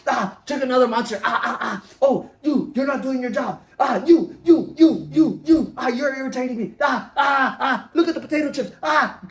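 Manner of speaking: building into a hammed-up caffeinated meltdown; mock irritated yelling. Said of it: "You're like".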